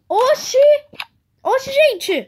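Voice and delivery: high, loud startled exclamation; startled shout. Real, not acted. Reacts to creature.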